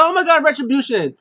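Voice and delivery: high, loud retold gasp of alarm; hammed-up high exclamation; excited reaction. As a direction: act out shock at a surprise arrival.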